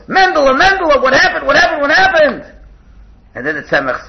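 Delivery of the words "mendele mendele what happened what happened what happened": high, loud, panicked calling; alarmed grandfather. Retold or imitated. Grandfather hears grandchild scream, loud high alarm. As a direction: rush to a hurt grandchild in alarm. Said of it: "the Baal Tanya screams".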